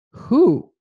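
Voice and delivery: startled high questioning who; confused agent turning around, surprised. Retold or imitated. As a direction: look round in sudden confusion.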